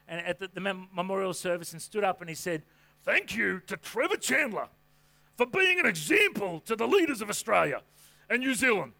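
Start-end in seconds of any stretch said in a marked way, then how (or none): 3.0-8.9 hammed-up impression of a church leader; Brian Houston. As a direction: put on a booming, swooping tribute from the platform. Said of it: "That was my Brian Houston impression".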